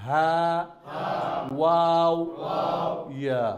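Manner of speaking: slow, evenly spaced letter call-out; group letter drill. Shown as a demonstration. Teacher leading the class in calling out each Arabic letter. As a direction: lead the class through the alphabet one name at a time.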